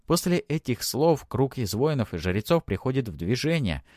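plain reading voice

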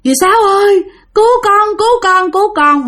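high-pitched, repeated plea for rescue; panicked cry for help. Acted. Terrified woman calls her aunt to save her, panicked. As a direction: call out desperately as if fleeing. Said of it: "bắt đầu cô kêu".

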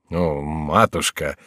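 a touch of embarrassment, drawn out; abashed exclamation. A narrator's reading. Son taken aback by his unexpected victory, abashed exclamation. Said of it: "вскричал Франсуа, смущённый своей неожиданной победой".

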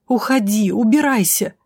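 mild cry of horror; terrified order. A narrator's reading. Heroine orders the drow away, shaken with horror. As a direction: urge them away in dread. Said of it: "Меня колотило от ужаса".

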